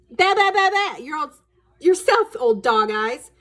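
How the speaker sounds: loud, high mocking retort; taunting boy. Brother jeers back at sister, loud and high. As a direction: throw the taunt right back. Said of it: "Timmy said".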